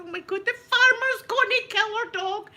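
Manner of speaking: high, loud frantic cry; panicked shout. Retold or imitated. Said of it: "And I'm going".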